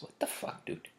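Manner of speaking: unvoiced exasperated what the fuck; whispered disbelief. Real, not acted. Venting about the girl at the bar, whispered disbelief. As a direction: breathe it out, fed up.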